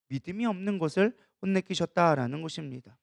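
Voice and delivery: ordinary talk, nothing marked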